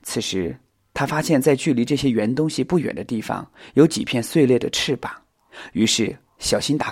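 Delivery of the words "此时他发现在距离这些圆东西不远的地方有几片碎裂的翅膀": ordinary delivery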